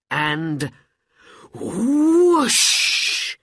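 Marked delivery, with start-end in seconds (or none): 1.5-3.4 very loud high whoosh; explosive rush of air and smoke; imitated whoosh. A narrator's reading. Storyteller making the lamp's magic noise, loud whoosh.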